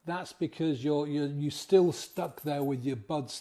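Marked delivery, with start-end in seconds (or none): none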